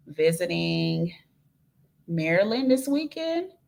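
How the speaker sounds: ordinary talk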